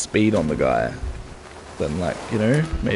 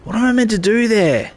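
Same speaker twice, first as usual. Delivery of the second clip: frustrated shout; 11 semitones higher than usual. Real, not acted. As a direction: yell at the screen in frustration. Frustrated yelling at game.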